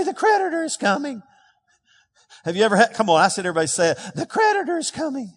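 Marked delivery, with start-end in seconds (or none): 0.0-1.2 squeaky high congregation echo. Real, not acted. Congregation echoing the preacher in a high voice. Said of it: "You don't have to say it in a high voice". 4.2-5.3 squeaky high congregation echo. Real, not acted. Congregation echoing the preacher in a high voice. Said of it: "You don't have to say it in a high voice".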